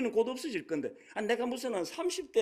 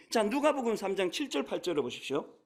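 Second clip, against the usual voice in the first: usual speaking voice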